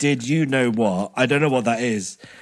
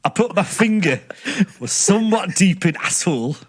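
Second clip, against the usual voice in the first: higher-pitched, hammed-up Yorkshire reading; Yorkshire accent; 6 semitones higher than usual. Shown as a demonstration. Reading the confession on request, Yorkshire accent. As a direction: lift it up and ham up the Yorkshire. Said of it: "in a Yorkshire accent for me".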